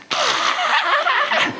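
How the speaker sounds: very high shocked squeal from the crowd; crowd's high horrified shriek; audience's shocked gasp. Retold or imitated. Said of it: "So you lot are all going".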